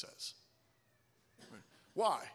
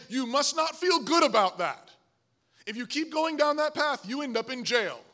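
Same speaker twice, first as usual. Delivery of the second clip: raised, higher-pitched warning; stern parental scolding; 5 semitones higher, 5 dB louder than usual. Acted. Parent disciplining son who hit his sister, stern scolding. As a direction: lay down the law to a child.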